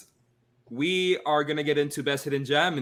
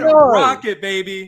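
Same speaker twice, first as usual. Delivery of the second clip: louder, higher excited shout; 5 semitones higher, 10 dB louder than usual. Real, not acted. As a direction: cheer the winner loudly. Celebrating win, louder.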